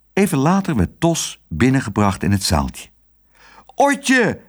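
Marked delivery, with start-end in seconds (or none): 3.7-4.4 high, joyful cry; high-pitched exclamation; calling out. A narrator's reading. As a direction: cry out to your child. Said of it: "riep hij".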